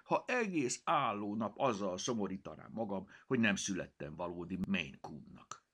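ordinary delivery

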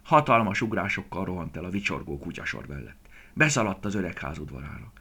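ordinary delivery

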